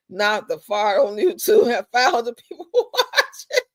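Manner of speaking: words breaking up into real laughter; laughing through words. Real, not acted. Streamer amused by fart livestream idea, laughing. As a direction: start talking, then crack up.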